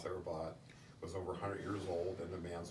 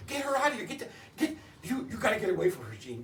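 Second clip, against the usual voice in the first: much higher and louder, frantic retold warning; aunt's panicked warning; 14 semitones higher, 8 dB louder than usual. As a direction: scream at him to get away from her. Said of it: "She says".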